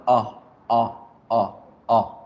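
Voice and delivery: "o" said with the mouth open as for A; short "o" between ah and oh. Shown as a demonstration. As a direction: drop the jaw for separate brief "o"s. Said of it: "la O corta".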